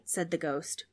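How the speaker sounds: ordinary delivery, nothing marked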